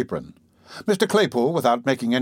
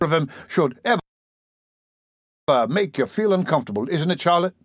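lightly voiced, higher tipsy youth; tipsy young man; 4 semitones higher than usual. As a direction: relish it as a slightly drunk lad. Voices tipsy Noah savouring oysters.

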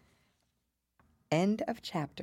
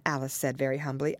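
plain reading voice

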